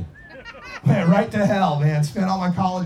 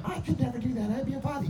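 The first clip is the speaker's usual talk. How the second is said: high, soft imitation of the wife; protesting wife; 6 semitones higher, 7 dB quieter than usual. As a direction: object in a small, high voice. Voices wife refusing joint.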